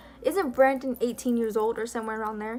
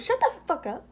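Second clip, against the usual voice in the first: high, dismissive retort; mock snap; 7 semitones higher than usual. Alex snapping at Brandon, mocked in a high voice. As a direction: toss it back with a sneer. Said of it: "And Alex turned around and said".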